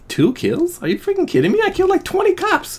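high outraged squeak; indignant disbelief. Real, not acted. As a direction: protest the kill count. Outraged at kill count.

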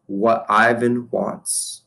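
"What Ivan wants," drawn out very slowly, leaning on "Ivan"; slow, doubting echo of his daughter's words; slow repeat. Acted. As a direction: repeat it back word by word, slowly. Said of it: "he slowly repeats".

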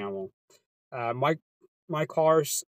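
ordinary talk

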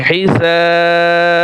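হিসাব with long held "saaa" and echoing b; qalqalah stop. Shown as a demonstration. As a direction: draw out "sa" in হিসাব, release the b with a bounce. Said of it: "এখানে কলকলা হবে". (টান দিয়ে হিসাব, শেষে কলকলা)

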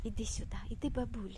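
unvoiced hushed coaxing; whisper. Real, not acted. Mother whispering to her toddler to come.

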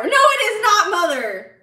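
high, loud protest at mom; indignant yell. Real, not acted. Streamer contradicting their mother about Diet Coke, indignant yell. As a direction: shoot back a loud, high-pitched objection.

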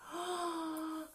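high drawn-in gasp of alarm; dramatic gasp. A narrator's reading. Narrator seeing the two bad men head for trouble, dramatic gasp. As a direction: gasp as if watching disaster coming.

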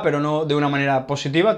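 ordinary talk

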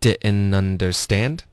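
very slow "didn't understand" as di'n-nunderSTAND; linked drill model. Shown as a demonstration. Teacher modelling a segment, slow linked "didn't understand". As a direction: drop the t in "didn't understand", rising slowly. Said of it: "我们来分段练一下".

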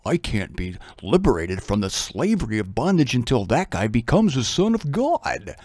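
low, soft dog's inner voice; voicing the dog. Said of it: "The dog says".

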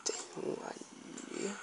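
slow hushed whisper. Real, not acted. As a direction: breathe it out warily. Spotting a zombie, hushed.

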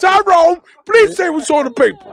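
high, pleading director, laughing through it; exasperated director. Acted. Director pleading with the actor Tyrone, high and exasperated. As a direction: beg the actor to just read the line.

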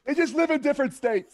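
high, laughing put-on voice; mock defensive excuse. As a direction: excuse yourself as the guy, giggling. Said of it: "imitating homeless guy".

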